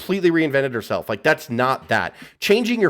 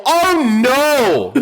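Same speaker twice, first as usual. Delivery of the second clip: high, loud cry of dismay; 9 semitones higher, 5 dB louder than usual. Retold or imitated. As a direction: yell it out in horror. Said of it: "out loud I go".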